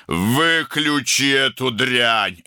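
high-pitched, drawn-out angry shout; furious yell. A narrator's reading. Older brother ordering the gramophone off, yelled angrily. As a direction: yell it, stretching the words. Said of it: "проорал Арун".

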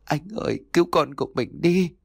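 sobbing plea. A narrator's reading. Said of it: "cô òa lên khóc nức nở".

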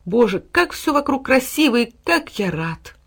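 slow, awestruck delight; joyful exclamation. A narrator's reading. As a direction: marvel happily at everything around. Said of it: "и радостно воскликнул".

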